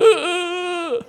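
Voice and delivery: mock high-pitched wheeze, struggling for air; winded gasp. Child winded from sister's punches, imitated high wheezing gasp. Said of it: "trying to get my wind back".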